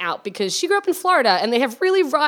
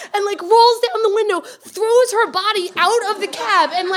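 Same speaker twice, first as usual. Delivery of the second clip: ordinary talk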